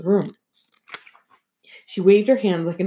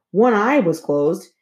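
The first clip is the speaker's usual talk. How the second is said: ordinary delivery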